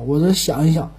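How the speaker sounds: normal talking voice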